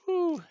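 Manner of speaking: high-pitched "whoo" of a thrown object; high, drawn-out whoosh noise. Shown as a demonstration. Imitates a thrown object flying.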